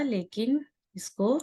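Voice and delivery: ordinary talk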